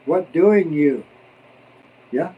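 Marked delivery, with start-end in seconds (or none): 0.1-1.1 slow, loud word-by-word gloss; WHAT DOING YOU, each word drawn out and emphatic. Shown as a demonstration. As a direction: spell out 'What doing you' slowly and loudly. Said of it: "That means".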